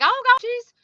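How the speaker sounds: high pitched gossiping busybody; nosy neighbor voice. Retold or imitated. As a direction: squeal it like an alarmed neighbor. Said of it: "somebody will be like".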